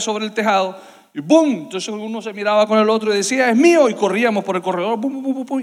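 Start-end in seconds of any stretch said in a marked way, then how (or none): 1.2-1.5 a short, loud boom for a falling mango; mango thud sound effect. Retold or imitated. Mango hits the roof. 3.5-3.9 put-on higher, louder kid's claim; excited claim. Children racing to claim the mango. 5.0-5.6 rapid string of booms, higher pitched; quick thudding "bum-bum-bum"; running footsteps imitation.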